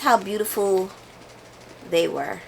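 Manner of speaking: testing the wrong title aloud; unsure guess. Real, not acted. Booktuber unsure of a title, softly guessing. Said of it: "Oh, which one is it?".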